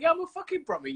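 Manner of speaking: high-pitched indignant comeback; retold retort, pitched right up. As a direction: throw it back in mock outrage. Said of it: "like a Brummie".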